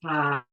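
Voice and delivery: loud, long open-throated ah; breathed-up open ah. Shown as a demonstration. Leader showing breath moving up into the voice, loud open ah. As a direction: breathe up and release a full ah. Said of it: "breathe it up into your throat".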